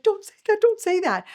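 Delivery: high, loud panicked hush-up; alarmed plea. Retold or imitated. Wife hushing husband's blunt remark to waitress, high loud alarmed plea. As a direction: beg him to take it back, fast and alarmed. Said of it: "I was like".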